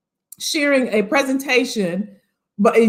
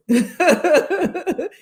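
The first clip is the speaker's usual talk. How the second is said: hearty laugh; 5 semitones higher than usual. Real, not acted. Laughing at her own line.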